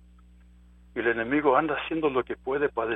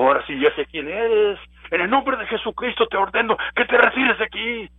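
higher, louder voice ordering the spirit away; commanding rebuke; 7 semitones higher, 4 dB louder than usual. Retold or imitated. Preacher reenacting ordering the gorilla spirit from his yard, stern rebuke. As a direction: command the demon to leave, with authority. Casting out spirit in Jesus' name.

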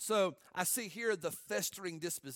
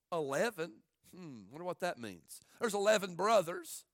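usual speaking voice

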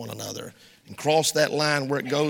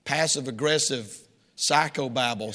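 ordinary delivery

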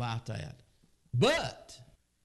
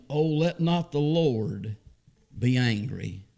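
reading Genesis aloud from the pulpit; measured, solemn King James reading; preacher reading scripture to the congregation; 5 dB louder than usual. Acted.

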